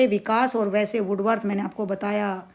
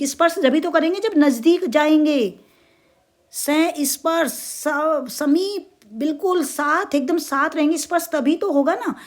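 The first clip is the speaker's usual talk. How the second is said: high explaining voice; 5 semitones higher than usual. Shown as a demonstration. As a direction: lift the voice to stress closeness. Explains closeness in raised voice. (ऊँची आवाज़ में समझाना)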